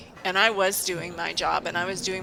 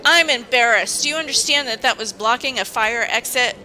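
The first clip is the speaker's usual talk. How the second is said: higher and louder, a retold reprimand; mock indignant boss; 4 semitones higher, 6 dB louder than usual. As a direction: play the exasperated boss, raised voice.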